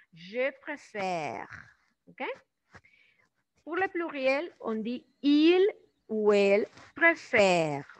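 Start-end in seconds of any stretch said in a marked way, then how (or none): 0.1-1.5 "Je préfère" slow, long è, silent final e; lengthened grave è. Shown as a demonstration. Teacher demonstrating the grave accent, "préfère" lengthened with silent ending. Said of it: "el sonido se alarga un pro". 6.1-7.9 loud, slow "préfère", ending left unpronounced; silent plural ending. Shown as a demonstration. Teacher showing the plural, "préfère" loud with silent ending. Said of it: "tampoco la estamos pronunciando".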